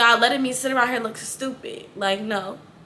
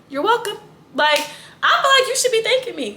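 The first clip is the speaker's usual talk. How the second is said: high, sassy mock pride; sarcastic; 8 semitones higher than usual. Real, not acted. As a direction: play it up like she owes you. Sarcastic aside to her friend.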